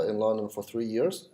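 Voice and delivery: usual speaking voice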